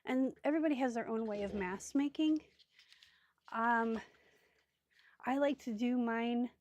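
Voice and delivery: usual speaking voice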